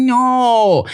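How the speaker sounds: put-on high, drawn-out child's refusal; refusing little daughter. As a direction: stretch it high like a stubborn kid.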